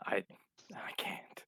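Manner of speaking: barely voiced whisper; faint, whispered admission; hushed despair. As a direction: breathe it out, defeated. Haunted character answers Molly's plea.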